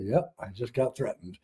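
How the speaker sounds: low, soft, deadpan acknowledgement; subdued reply. Retold or imitated. Husband answering his panicking wife, quiet understatement. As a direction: drop it low and quiet, matter-of-fact.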